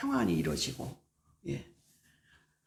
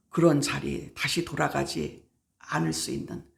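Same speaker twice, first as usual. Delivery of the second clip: ordinary talk, nothing marked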